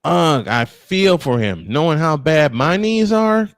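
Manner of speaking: usual speaking voice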